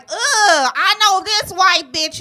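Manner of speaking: high, loud, slow sassy scoff. Retold or imitated. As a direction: drag it out with attitude. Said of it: "she was like".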